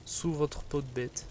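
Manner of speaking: usual speaking voice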